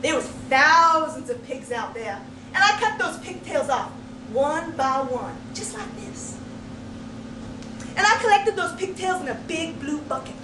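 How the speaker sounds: ordinary delivery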